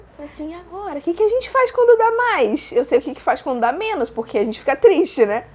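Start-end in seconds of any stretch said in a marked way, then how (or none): 0.5-2.7 high-pitched retold fluster; mock panic. Woman facing her positive test, mock panic. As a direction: act out being at a loss, pitch climbing.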